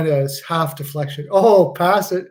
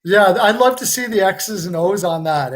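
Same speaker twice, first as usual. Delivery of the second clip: usual speaking voice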